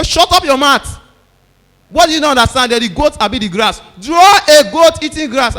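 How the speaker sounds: shouting, rising into a full scream; furious teacher bellowing at a pupil; teacher's angry yell. Retold or imitated.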